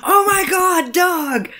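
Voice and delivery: very high wail at a dog; high-pitched mock scolding; exasperated mock yell. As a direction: cry it out in hammed-up exasperation. Mock-yells the suggested dog name.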